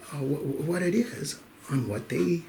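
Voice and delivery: usual speaking voice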